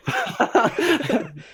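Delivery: sudden high laugh; high burst of laughter. Real, not acted. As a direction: laugh out loud. Laughing at the joke.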